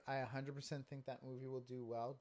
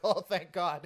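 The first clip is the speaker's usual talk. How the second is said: relieved Spider-Man; 5 semitones higher, 11 dB louder, slower than usual. Retold or imitated.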